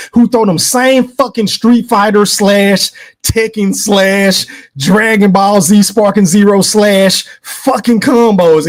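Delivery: normal talking voice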